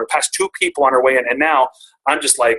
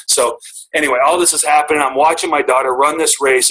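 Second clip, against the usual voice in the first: normal talking voice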